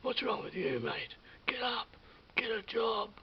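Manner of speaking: loud mock shout of an annoyed driver; retold yelling. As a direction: shout it at the guy lying in the road. Driver yelling at him lying down.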